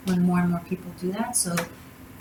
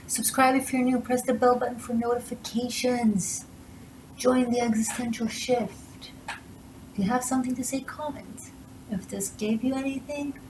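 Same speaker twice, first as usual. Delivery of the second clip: higher, sing-song promo read; outro plug; 5 semitones higher, 3 dB quieter than usual. Acted. Reader asking viewers to subscribe and like, upbeat promo. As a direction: chirp the channel reminders.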